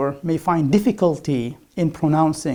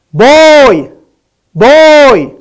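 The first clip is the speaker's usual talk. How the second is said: "Boy!" yelled very loud and higher, with a voiced b; shouted boy; 7 semitones higher, 14 dB louder than usual. Shown as a demonstration. Teacher shouting boy at the paper to show it won't move. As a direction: belt out "Boy!" at full volume. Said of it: "I will shout".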